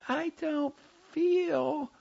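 very high, slow, stretched-out squeak; unnaturally high, drawn-out child voice; high, artificial voice. A narrator's reading. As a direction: recite it in a fake falsetto. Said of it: "whispered in a high, artificial voice".

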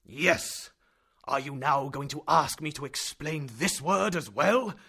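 loud, high mocking challenge; sneering professor. A narrator's reading. Professor mocking nephew, loud and sneering. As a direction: taunt him impatiently.

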